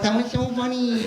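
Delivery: put-on high, giggly child's voice; amused little kid. Other kids laughing at fall.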